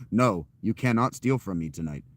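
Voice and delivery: loud, projected telling-off; firm, booming refusal to a customer; threatening refusal. Acted. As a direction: lay down the law at full projection. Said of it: "it's threatening".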